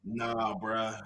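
shrugging it off; deadpan nah. Real, not acted. Flat dismissive denial.

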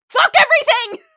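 high, loud, laughing blowup of frustration; mock meltdown yell. Everyone before a deadline losing it, a laughing mock scream. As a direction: scream it out like one tiny thing broke you, half laughing.

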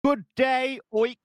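upper-class English snob; posh Queen's English. Acted. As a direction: look down your nose in posh Queen's English. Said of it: "really cliche British... Southern Queen's English".

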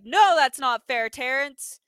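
loud, emphatic retold no; firm imitated rebuke; mock judge's ruling. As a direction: come down hard on the opening word.

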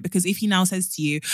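ordinary talk

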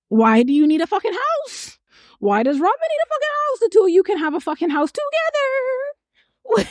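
high, quick heated rant. Real, not acted. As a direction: press the point, rising outrage. Ranting at the couple.

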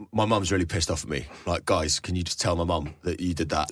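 low, soft mock plea from a told-off kid; sheepish friend pleading. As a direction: mumble it like a boy in trouble.

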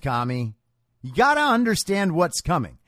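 normal talking voice

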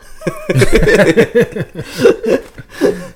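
big high burst of laughter; loud laugh. Real, not acted. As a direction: laugh hard. Laughing after joke.

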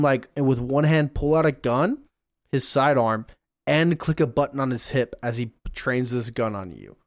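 ordinary talk, nothing marked

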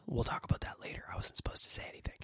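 hushed, whispered aside; secretive whisper. Real, not acted. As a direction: let a secret slip under your breath. Lets a secret slip, hushed aside.

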